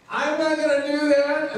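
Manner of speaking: high-pitched mock insistence; mock protest. Someone just like Peter swearing they won't, mock insistent. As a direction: protest in a comically high, stubborn voice.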